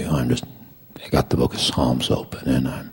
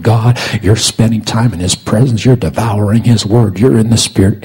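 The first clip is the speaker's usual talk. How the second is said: impassioned preaching; 6 semitones higher, 6 dB louder than usual. Real, not acted. Preacher tells beloved congregation about God's living word, impassioned preaching. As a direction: preach it with mounting force.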